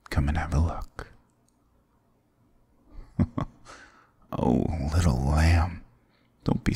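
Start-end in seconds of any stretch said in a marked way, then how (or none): 3.0-3.4 brief, soft mocking chuckle; amused villain chuckle. Demon ruler to the wary hero, soft amused chuckle. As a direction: chuckle quietly at their fear.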